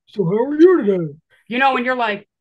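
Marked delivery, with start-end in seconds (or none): 0.1-1.1 loud, overfriendly phony question; disguised stranger making odd small talk; mock stranger chat. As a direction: boom it out like a fake neighbor. Masked stranger starts chat.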